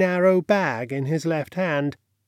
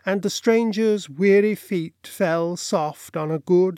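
plain reading voice

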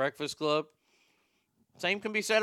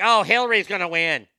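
mock Boston coworker; 3 semitones higher, 8 dB louder than usual. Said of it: "she was from Boston".

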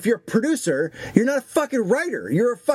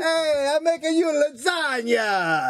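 higher, hammed-up cartoon Italian accent; hammy Italian host offering food; 4 semitones higher than usual. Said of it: "my idea of Italy is so fucking cartoony".

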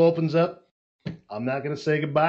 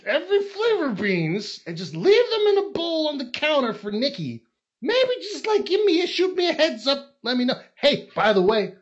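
mock pleading husband; 9 semitones higher, 4 dB louder than usual. Mock message to wife.

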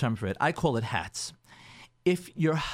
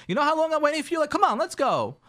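loud, high impatient scolding; hammed-up fuming wife; 10 semitones higher, 4 dB louder than usual. Wife scolding husband for coming back late, loud and high. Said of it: "she's fuming".

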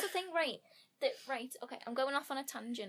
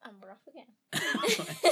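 wry slow announcer; 2 semitones lower, 9 dB louder, slower than usual. Pretend. Mock announcer on tangent.

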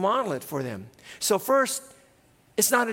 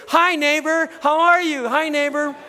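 much higher and louder, beaming hellos; cheerful greeting; 8 semitones higher, 4 dB louder than usual. Shown as a demonstration. Pastor modelling the greeting for the congregation, loud and beaming. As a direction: greet the neighbours like old friends. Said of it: "just say".

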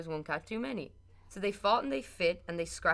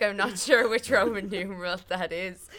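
laughing; 3 semitones higher, 8 dB louder than usual. Retold or imitated. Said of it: "I was like".

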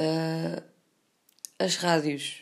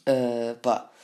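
usual speaking voice

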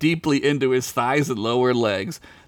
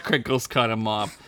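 ordinary delivery

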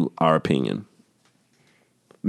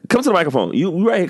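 normal talking voice

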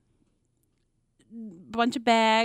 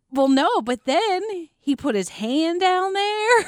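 mock phone gossip; 7 semitones higher, 4 dB louder, slower than usual. Mimicking the girl's phone confession.

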